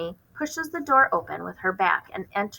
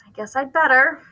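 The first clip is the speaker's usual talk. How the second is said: reluctant; 7 semitones higher, 6 dB louder than usual. Acted. Mother giving in reluctantly.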